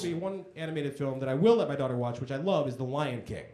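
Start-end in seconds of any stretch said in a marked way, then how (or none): none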